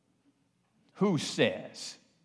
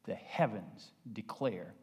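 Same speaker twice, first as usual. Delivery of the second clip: soft, low, earnest altar call; a hushed, earnest invitation; quiet closing appeal; 6 semitones lower, 8 dB quieter than usual. Acted. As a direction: draw them in gently at the close.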